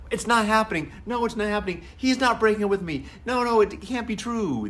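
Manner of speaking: put-on high, insistent voice of a heartbroken teen refusing the news; teen in denial. Daughter refusing to believe the breakup, high and insistent. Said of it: "You know, the, the denial".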